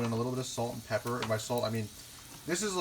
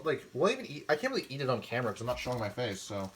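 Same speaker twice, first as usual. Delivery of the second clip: usual speaking voice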